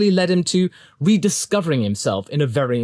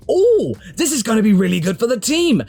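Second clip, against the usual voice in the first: eager, higher and brighter; excited; 6 semitones higher than usual. Retold or imitated. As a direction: gush with hope. Retelling own hopeful thought.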